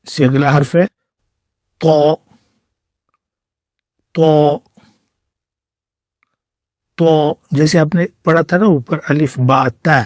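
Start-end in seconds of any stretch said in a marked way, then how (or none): 1.8-7.3 طا thick and hard, a heavy t; heavy Arabic ta. Shown as a demonstration. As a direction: punch a hard, full طا. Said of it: "موٹے اور سخت طریقے سے".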